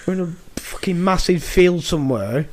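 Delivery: normal talking voice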